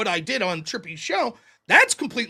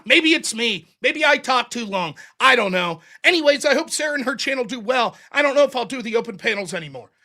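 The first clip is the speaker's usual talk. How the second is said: ordinary delivery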